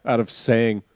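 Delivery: usual speaking voice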